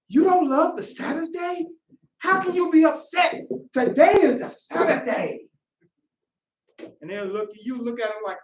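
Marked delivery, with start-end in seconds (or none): 0.1-5.5 high, incredulous enthusiasm; overjoyed disbelief. Acted. Happy brother asking why you're upset on Sabbath, high excitement. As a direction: press them, thrilled and astonished. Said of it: "Happy for the Sabbath day to come".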